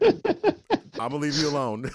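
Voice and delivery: son backing off. Retold or imitated. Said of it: "He was like".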